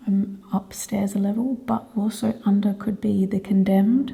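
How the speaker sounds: soft breathy whisper. Real, not acted. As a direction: whisper it, keep very still. Whispering during ghost hunt.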